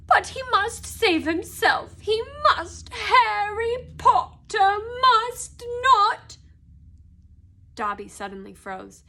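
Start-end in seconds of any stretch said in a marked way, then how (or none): 0.1-6.4 high, insistent wail, slowing at the end; moaning elf. A narrator's reading. Dobby insisting Harry save himself, wailing. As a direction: plead high, stretching the final words. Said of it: "moaned Dobby in a kind of miserable ecstasy".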